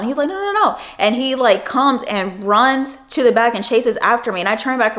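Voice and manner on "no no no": much higher, singsong no no no; mock his stubborn refusal; a coworker brushing off friends, high and sing-song.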